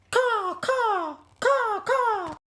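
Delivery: high squawking bird cries; puffin caws. Acted. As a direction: squawk like panicked seabirds. Puffins crashing in panic.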